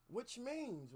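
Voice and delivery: normal talking voice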